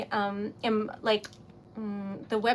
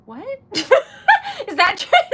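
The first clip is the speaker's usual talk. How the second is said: normal talking voice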